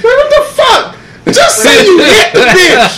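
cracking up while yelling it; laughing shout. Real, not acted. Laughing at friend's rambling.

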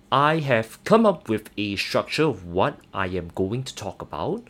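normal talking voice

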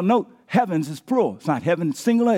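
ordinary talk

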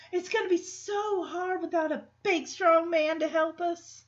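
a hint of a helpless Southern belle. [name] playing helpless to the guys, mild Southern belle. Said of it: "why is she acting like a Southern belle".

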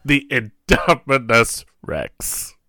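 very slow, drawn-out dramatic naming; movie character impression. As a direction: savour each syllable like the character in the film. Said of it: "tried to say it like he does in the movie".

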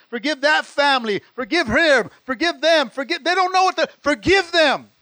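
loud, high pleading forgive; preaching prayer. Acted. Pastor voices Jesus praying for everyone, loud and high. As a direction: beg heaven for them at full force.

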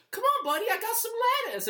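high coaxing pet voice; coaxing a turtle. Retold or imitated. Coaxing a turtle out of its shell, high sweet voice. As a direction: coo at him like a pet turtle.

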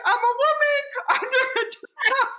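very high, loud wail; hammed-up tearful squeal; mock crying. Stuck driver pleading to the state trooper, mock crying. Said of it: "I just start crying".

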